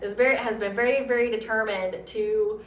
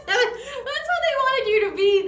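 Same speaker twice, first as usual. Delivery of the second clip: laughing; 13 semitones higher, 4 dB louder, much slower than usual. Real, not acted. Laughing at the absurd dating TikTok.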